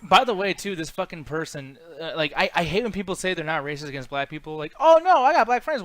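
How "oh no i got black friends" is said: high, loud mocking quote; mock denial. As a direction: imitate a protesting excuse-maker, pitched up. Mockingly voicing people denying racism.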